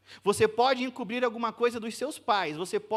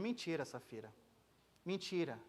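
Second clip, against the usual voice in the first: low, much softer accusation; grave quiet verdict; 5 semitones lower, 11 dB quieter than usual. Acted. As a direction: say it lower and hushed. Peter condemns Safira.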